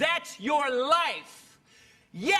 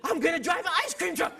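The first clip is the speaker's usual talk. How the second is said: put-on excited kid; 6 semitones higher than usual. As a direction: blurt it like an eager child.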